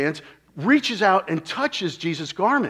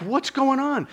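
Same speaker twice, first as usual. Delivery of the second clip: a higher, mock exasperated cry; exasperated father; 9 semitones higher than usual. An impatient father asking why Jesus stopped, mildly exasperated. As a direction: play the impatient father losing patience. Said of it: "I'd be blowing a gasket".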